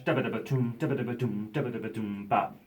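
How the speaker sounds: rolling vocal drum pattern; imitated drum feel. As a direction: mouth the drum fill in rhythm. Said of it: "the feel I was trying to achieve".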